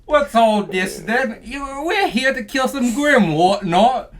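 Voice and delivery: very high, slow fake English; purposely bad English accent. As English hunters here to kill grim, hammy accent. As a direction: stretch it into a silly English accent. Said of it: "that was a purposely bad accent".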